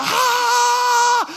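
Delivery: high, loud imitated shriek of shock; mock scream. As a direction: shriek like the wife finding him out. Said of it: "escandalosamente grita". (grito escandaloso imitado, agudo)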